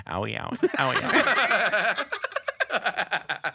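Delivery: high, laughing imitation of a hurt friend's whine; mock whimpering owie. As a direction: whine it like a hurt kid, cracking up. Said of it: "all I keep hearing is him going".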